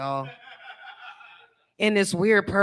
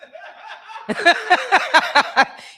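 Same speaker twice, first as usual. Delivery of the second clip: loud high laugh; 10 semitones higher, 6 dB louder than usual. Real, not acted. Laughs at crowd.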